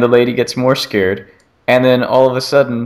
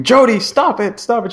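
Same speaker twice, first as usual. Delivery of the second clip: mock scream; 13 semitones higher than usual. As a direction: imitate the scared lady begging. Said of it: "they're all screaming".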